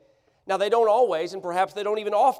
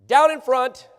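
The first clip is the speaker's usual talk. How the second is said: loud "down in front" call; 6 semitones higher, 9 dB louder than usual. Shown as a demonstration. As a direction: call out "Down in front" loudly, like at a stadium. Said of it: "what do we typically say?".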